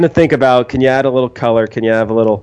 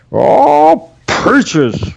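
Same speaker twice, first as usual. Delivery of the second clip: put-on cackly wheezy old timer; high cackling gold miner; old prospector voice; 11 semitones higher, 3 dB louder than usual. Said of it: "but it's like".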